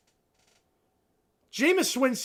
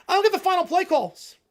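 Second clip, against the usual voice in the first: frustrated rant; 8 semitones higher, 6 dB louder than usual. Real, not acted. Ranting about play calls.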